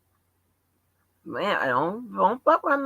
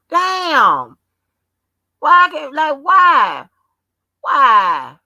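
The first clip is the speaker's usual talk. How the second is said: loud, frustrated why, why; exasperated shout; 6 semitones higher, 9 dB louder, much slower than usual. Real, not acted. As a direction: yell it in disbelief. Exasperated at angry chatters.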